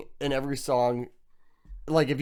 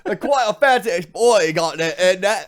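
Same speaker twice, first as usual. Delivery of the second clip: mock British accent; 4 semitones higher, 4 dB louder than usual. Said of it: "talk like the British".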